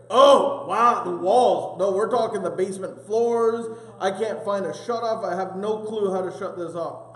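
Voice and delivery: loud, high frantic burst that settles down; frantic exclamation. Retold or imitated. The same guy still on the phone, frantic and loud. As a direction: blurt it out in alarm, then calm a little. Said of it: "He's like".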